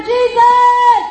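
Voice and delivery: very loud, high, long-held yell; full-force cry over the crowd. Acted. Hushed blind man calls Jesus harder, desperate shout. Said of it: "cry out even louder".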